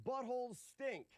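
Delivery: soft, high silly repeat; quiet. Pretend. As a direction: say it again under your breath. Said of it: "Ready? Watch this".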